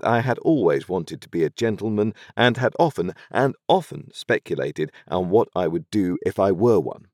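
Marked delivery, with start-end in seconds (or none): none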